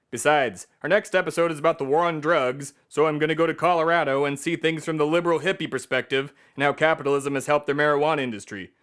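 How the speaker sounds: ordinary talk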